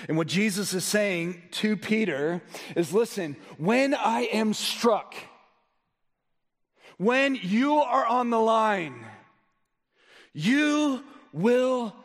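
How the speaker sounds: high, slow, weighty pulpit delivery; emphatic preaching. Real, not acted. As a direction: stretch the warning out for the congregation. Preaches at full force.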